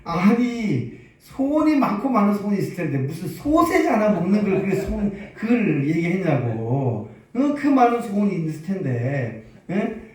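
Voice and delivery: high, loud scolding of a foolish husband; angry wife. Acted. Wife scolding husband over wasted wish, angry and loud. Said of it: "얼마나 화를 내면서".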